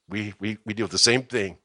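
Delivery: ordinary talk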